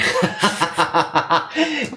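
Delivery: burst of laughter. Real, not acted. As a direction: laugh helplessly for a good two seconds.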